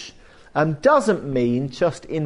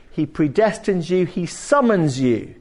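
ordinary talk

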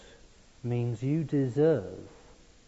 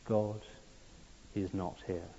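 much softer, hushed echo; whispered; 2 semitones lower, 11 dB quieter than usual. A narrator's reading. Hideous echo whispering the final judgment to the lost man. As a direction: breathe the verdict quietly. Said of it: "whispering that most horrifying of judgments".

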